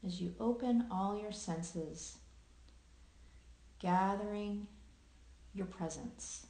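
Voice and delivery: unhurried meditation guiding with long pauses; guided meditation. Acted. Host guiding listeners into present awareness, calm meditation voice with long pauses. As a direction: lead listeners gently through their senses, leaving long silences.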